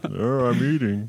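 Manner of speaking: sleepy mumbling bear; drowsy bear muttering. Acted. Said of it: "That was my bear voice".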